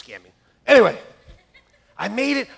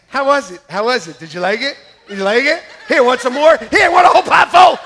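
eager cook asking; 7 semitones higher, 13 dB louder than usual. Retold or imitated. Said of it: "you're always asking".

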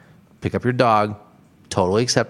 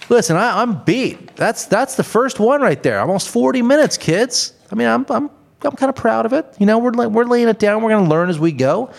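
much higher, bright and upbeat; excited wrap-up; 9 semitones higher than usual. Real, not acted. Host proudly wrapping up first episode, high excited. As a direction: lift the voice high with pride.

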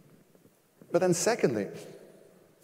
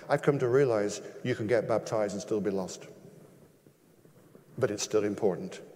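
ordinary delivery

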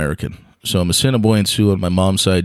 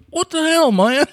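high, incredulous what-the-hell; the rodeo organizer reacting to the song; baffled rodeo boss. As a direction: sound stunned at the wrong music.

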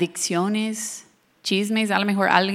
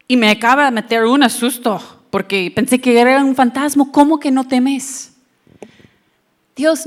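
frightened plea; 4 semitones higher, 5 dB louder than usual. Acted. Voicing a frightened believer.

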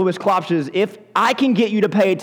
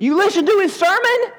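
much higher, disbelieving question; can't believe what they're hearing; incredulous; 12 semitones higher than usual. Acted. Priests scolding officers for listening, high disbelief.

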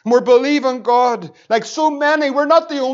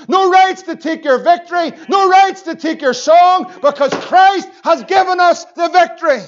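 building, higher and louder preaching; preaching at full force; 4 semitones higher, 4 dB louder than usual. Real, not acted. Preacher rousing the saints, louder and higher. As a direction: drive it home to the church.

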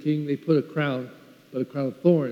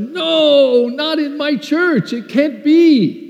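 high, drawn-out cry of refusal; loud, high, dismayed outcry; anguished disbelief; 10 semitones higher, 5 dB louder, much slower than usual. Retold or imitated. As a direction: wail it in protest, stretching the words. Cries out his disbelief to himself.

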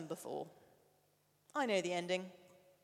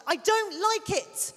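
slow, high, loud frightened cry; child shouting; 10 semitones higher, 9 dB louder, slower than usual. Retold or imitated. Son shouting from the other room, upset. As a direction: shout it like a scared little boy. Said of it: "he shouted".

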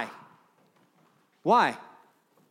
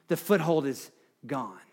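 ordinary delivery

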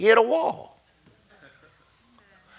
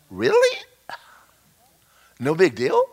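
incredulous. Retold or imitated. Incredulous reaction.

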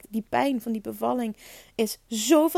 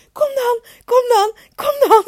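high, loud retold urging; desperate call; 12 semitones higher, 10 dB louder than usual. Mother calling to baby during birth, loud desperate urging. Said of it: "weet ik ook nog dat ik geroepen heb".

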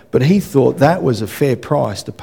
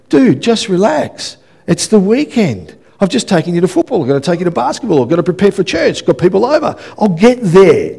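exasperated dad; 5 semitones higher than usual. Retold or imitated. Dad to son nagging to go over, mock exasperated. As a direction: fend off a nagging kid, acted exasperation. Father answers son's repeated nagging.

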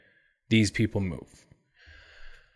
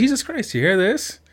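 much higher exclaim of shock; shocked exclamation; 9 semitones higher than usual. Retold or imitated. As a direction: call out in shock to the people beside you. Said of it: "I was like".

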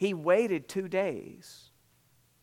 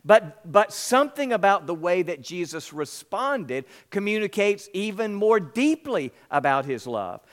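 ordinary delivery

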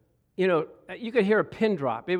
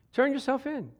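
pitched up, pleading call to turn yourself in; preacher pleading higher the second time; higher urging; 9 semitones higher than usual. Acted.

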